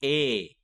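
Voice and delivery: "A" drawn out as its letter name; long vowel. Shown as a demonstration. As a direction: model a long, clear "A" for repeating. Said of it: "say each long vowel sound I say".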